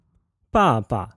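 爸爸 falling, then short and low; fourth plus neutral tone. Shown as a demonstration. Teacher models 爸爸 for repetition, falling then neutral tone. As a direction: drop the first syllable of 爸爸, keep the second light. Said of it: "B A with a fourth tone".

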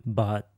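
BUT said low. Shown as a demonstration. Teacher demonstrates tones with English sounds, low tone. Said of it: "repeat the English sounds after me".

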